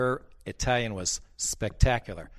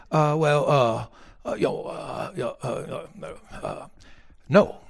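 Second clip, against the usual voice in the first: flustered stammer; 4 semitones higher, 4 dB louder, slower than usual. Retold or imitated. Caller stammers, caught out.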